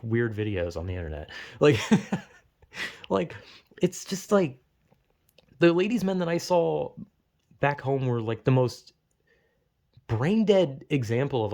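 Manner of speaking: usual speaking voice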